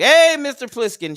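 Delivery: high, loud hail to a viewer; excited shout-out. Real, not acted. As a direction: call his name out big.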